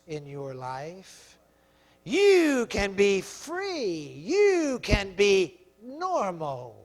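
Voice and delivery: hushed, low, coaxing voice; soft seductive coaxing, slowing to drawn-out words; devil's tempting whisper. Acted. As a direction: murmur temptation into the listener's ear. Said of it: "He's gonna whisper in your ear".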